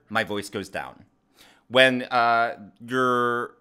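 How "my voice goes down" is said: "down" drops low to close the statement; falling tone. Shown as a demonstration. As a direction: let the pitch sink to a clear finish. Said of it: "there is a very clear".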